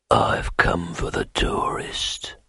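slow, menacing whisper. A narrator's reading. Assassin telling the thief master he wants the tourist, whispered. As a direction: breathe the threat.

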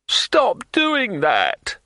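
high, slow, loud shriek. A narrator's reading. Innkeeper under the table, shrieking at the brawlers. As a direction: shriek it out in alarm. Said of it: "shrieked Broadman".

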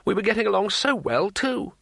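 plaintive, rising to high pitch; moaning. Acted. Tourist lamenting the fire to the wizard, moaning. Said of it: "moaned Two Flower".

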